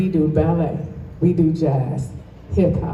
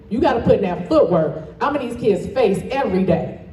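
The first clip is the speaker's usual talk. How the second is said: normal talking voice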